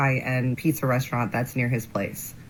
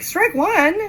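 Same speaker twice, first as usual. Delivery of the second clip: sassy; 13 semitones higher, 4 dB louder than usual. Retold or imitated. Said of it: "I'm like".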